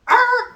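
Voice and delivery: sudden high, loud yelp; startled scream. Retold or imitated. Spotting the stalker's Honda Accord, a high startled yelp. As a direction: jump out of your skin. Startled seeing her car.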